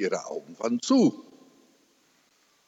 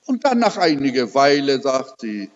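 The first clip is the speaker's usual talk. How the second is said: ordinary delivery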